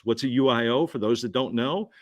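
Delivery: ordinary talk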